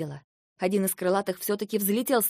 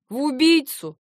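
raised, incredulous question; appalled disbelief; 5 semitones higher, 7 dB louder than usual. Acted. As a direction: blurt it out in shock. Brother appalled at her love. (потрясённо, громко)

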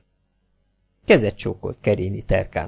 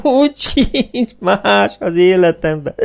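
ranting heroine; 6 semitones higher than usual. Acted. Csillagsári rants on, other voice.

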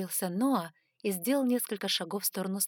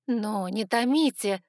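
louder, drawn-out plea; impatient exclamation; 2 semitones higher, 7 dB louder, slower than usual. A narrator's reading. Dragoness urging the elder to go on, impatient exclamation. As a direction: exclaim it impatiently, stretching the words. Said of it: "воскликнула одна из драконец".

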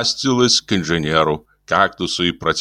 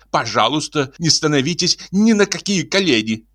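high, commanding female voice; stern wife; 8 semitones higher than usual. Acted. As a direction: forbid it firmly as lady of the house. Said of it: "сказала она грозно".

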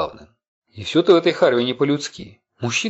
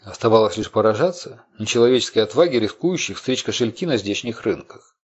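ordinary delivery

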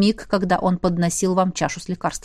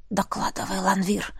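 weak wincing voice; 7 dB quieter than usual. Acted. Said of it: "поморщился принц".

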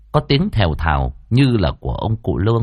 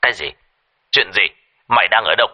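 brusque, mildly scolding questions; near shouting; much faster than usual. Acted. Father answering his son's alarming night call, mildly scolding. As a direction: fire off short, stern questions. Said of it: "nói như quát".

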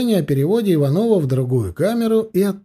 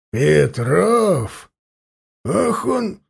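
exclaiming; 5 semitones higher, much slower than usual. A narrator's reading. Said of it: "воскликнул Иванов".

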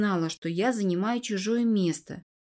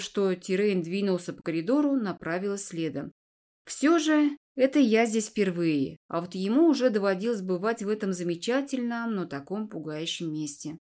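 ordinary delivery, nothing marked

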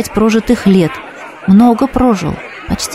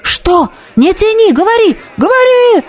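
much higher, urgent impatient cries; impatient crowd; 13 semitones higher than usual. Acted. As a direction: hurry the old man to speak. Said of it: "crowd urges the seer". (выше, нетерпеливо торопя)

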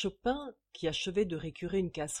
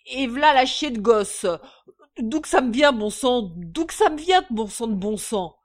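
louder, muddled exasperation; befuddled swearing; 2 semitones higher, 8 dB louder than usual. A narrator's reading. Drunk father confused counting his children, cursing. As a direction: lose count and curse.

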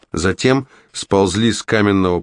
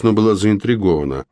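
usual speaking voice

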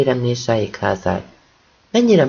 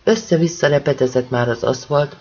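usual speaking voice

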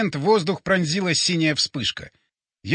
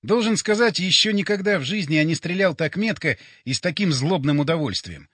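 usual speaking voice

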